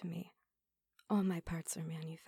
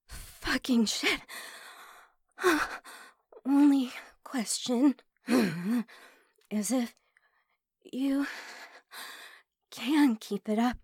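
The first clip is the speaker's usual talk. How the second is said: higher, louder, panting words; breathless ecstatic; 5 semitones higher, 10 dB louder than usual. Acted. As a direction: gasp it out in ecstasy. Breathless climax, loud and high.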